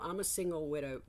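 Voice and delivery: ordinary delivery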